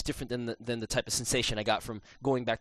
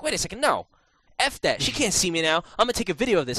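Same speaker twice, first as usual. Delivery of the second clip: higher, louder defiant resolve; growing defiance; 7 semitones higher, 5 dB louder than usual. Retold or imitated. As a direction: talk yourself into rebellion. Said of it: "it was just like".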